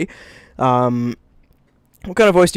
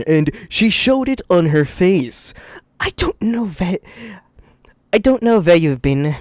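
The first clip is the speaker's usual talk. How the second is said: reading a story aloud; story narration; 3 semitones higher than usual. Acted. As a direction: read it like narrating a novel. Reads the fanfic aloud.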